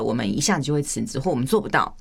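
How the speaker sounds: ordinary talk, nothing marked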